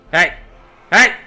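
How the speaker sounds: high, loud yell to scare them off; furious shout. Acted. Commander roaring at the beastmen to flee, furious. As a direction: roar at the fleeing beasts.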